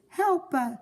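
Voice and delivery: high, slow pleading calls; repeated cries for help. A narrator's reading. Trapped couple begging the firemen from inside, calling for help. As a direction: keep calling out, growing desperate.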